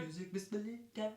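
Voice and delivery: playful silly voice. Shown as a demonstration. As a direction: say it like a goofy character. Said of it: "in your silly voice".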